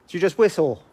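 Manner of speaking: high, loud call into the dark; talking to a ghost. Real, not acted. Questioning the spirit aloud.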